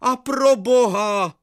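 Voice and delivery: put-on high, drawn-out old man's cry; teacher's alarm. Teacher calls after the fleeing pupil, high and slow. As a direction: wail it like a frightened old teacher. Teacher leaning over railing.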